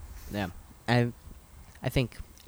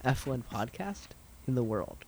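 announcer; 4 dB quieter than usual. Acted. Hyped podcast slogan.

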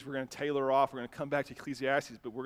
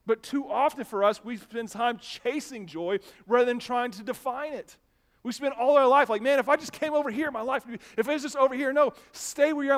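high, loud pulpit delivery; impassioned preaching; 8 semitones higher, 9 dB louder than usual. Real, not acted. Pastor urging church to stop chasing joy, impassioned preaching. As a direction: preach it with rising urgency and punch.

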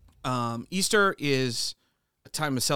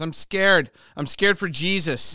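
put-on loud, higher-pitched quote of a worried little kid; little girl speaking up; 4 semitones higher, 7 dB louder, slower than usual. Friend retells a little girl at children's church, imitated speaking up. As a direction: imitate a small child blurting out, louder and higher. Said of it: "she, like, speaks up".

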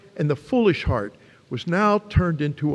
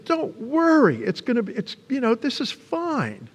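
high, sing-song fake reassurance; mock soothing; 8 semitones higher than usual. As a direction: coo it like empty comfort. Mock comforting words Jesus didn't say.

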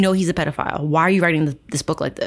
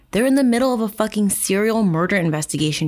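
ordinary talk

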